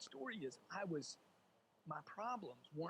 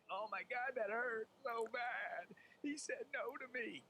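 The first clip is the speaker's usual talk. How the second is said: higher, whiny, slow mock hurt; mock whining; 8 semitones higher, 3 dB louder, slower than usual. Mocking a rejected salesman, whiny and slow. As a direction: whimper it like a wounded kid.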